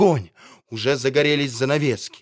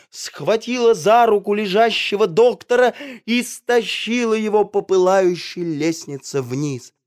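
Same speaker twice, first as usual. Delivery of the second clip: ordinary delivery, nothing marked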